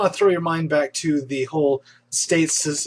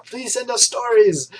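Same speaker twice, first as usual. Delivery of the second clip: higher, slow pleading appeal; 7 semitones higher than usual. Real, not acted. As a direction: beg the viewers, drawn out. Begging viewers for stories.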